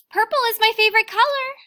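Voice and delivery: a sudden rushed outburst to a sad friend; excited blurt. Acted. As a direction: blurt it out eagerly like a nervous kid. Child narrator blurts to classmate.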